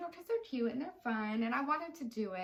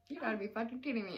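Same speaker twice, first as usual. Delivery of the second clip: fast, laughing disbelief; faster than usual. Retold or imitated. Friend voicing her shocked reaction with a laugh. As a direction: blurt it out through a laugh.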